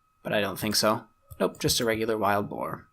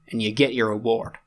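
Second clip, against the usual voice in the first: ordinary talk, nothing marked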